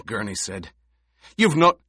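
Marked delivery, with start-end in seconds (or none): 1.4-1.8 loud, high cry cut off; shout. A narrator's reading. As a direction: yell then stop short.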